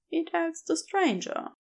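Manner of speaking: ordinary delivery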